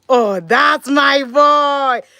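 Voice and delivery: drawn-out, loud proud cheer; cheering her son on, slow and loud. Real, not acted. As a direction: stretch it out like a hype cheer. Mom cheering son feeding himself.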